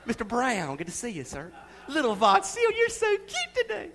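sugary, high churchy greeting; a parent greeting church folks in a sweet Sunday voice; prim church manners. Retold or imitated. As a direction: put on a mock sweet Sunday voice. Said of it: "we act all proper and reserved".

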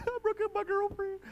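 very high, squeaky fake blubber; exaggerated tearful wail; mock crying. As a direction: bawl it like a baby, played for laughs. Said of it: "talking on the aisle to people and crying".

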